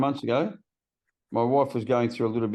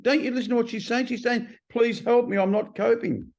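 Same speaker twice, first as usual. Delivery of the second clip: high-pitched retold telling-off; high, emphatic appeal; exasperated reproach; 10 semitones higher than usual. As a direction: imitate a sponsor urging him to listen. Sponsor pleading wife's case to him.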